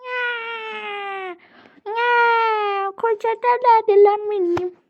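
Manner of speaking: high, whining mock sobs; play-acted crying. Pupil mourning the lost school bag, tearful whine.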